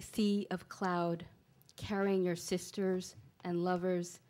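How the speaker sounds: slow, measured poem recital with pauses between images; poetry reading. Acted. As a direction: read each line deliberately, letting it land. Reads own poem aloud.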